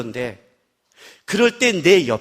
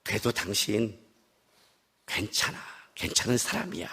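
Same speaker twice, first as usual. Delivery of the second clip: very soft, low reassurance; comforting wife; 12 semitones lower, 12 dB quieter than usual. Retold or imitated. Wife reassuring her husband he is alright, tender. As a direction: hush it tenderly, pitched low.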